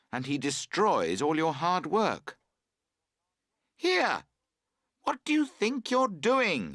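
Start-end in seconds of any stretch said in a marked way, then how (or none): 3.8-6.7 high, loud indignant call. A narrator's reading. Camper yelling at his friend pulling the tent, loud and indignant. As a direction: yell across at the careless tent partner. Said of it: "you call out".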